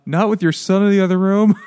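hammed-up nervous teen; mock nervous excuse. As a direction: wriggle out of it. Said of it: "he's like".

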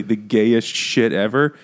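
ordinary talk

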